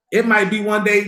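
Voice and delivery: ordinary delivery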